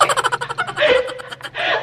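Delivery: a long high giggle; airy high laugh. Real, not acted. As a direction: laugh at the family's surprise. They thought she looked new.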